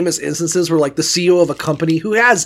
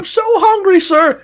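pleading homeless man; 14 semitones higher, 4 dB louder than usual. Retold or imitated. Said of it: "homeless guy under a bridge".

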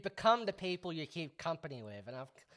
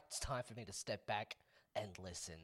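low, soft mock-solemn statement; quoted apology; 8 semitones lower, 14 dB quieter than usual. Host imitates comedian's public apology, mock-solemn. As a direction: drop low and quiet, as if humbly apologising. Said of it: "he's like".

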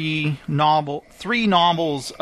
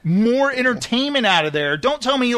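higher-pitched, insistent arguing; animated self-quote, pitched up; retold protest; 6 semitones higher than usual. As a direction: argue back in mock indignation. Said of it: "And I'm like".

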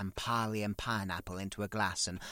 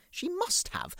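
high indignant. Real, not acted. Insists again.

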